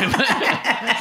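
loud high laugh; burst of laughter. Real, not acted. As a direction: break into a big laugh. Laughing at own joke.

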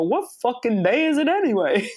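mock Long Island accent, lightly done. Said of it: "he's got a thick Long Island accent".

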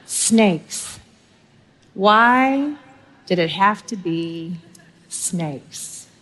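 slow, drawn-out Indiana Jones dread, with a near-silent final snakes; Indy's line with long pauses and the last snakes dropped away; lightly voiced Indiana Jones, dreading the snake pit. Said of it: "ask with the great theologian Indiana Jones".

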